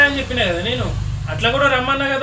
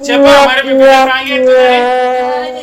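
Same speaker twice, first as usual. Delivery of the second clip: higher, much louder complaint; raised-voice protest at being pranked; loud reproach; 4 semitones higher, 11 dB louder than usual. Real, not acted. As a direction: call mother-in-law out, drawing out the end. Reproaches mother-in-law over prank.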